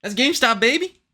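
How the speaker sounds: sing-song tease, pitch jumping up; playful, high. Real, not acted. Teasing friend about GameStop.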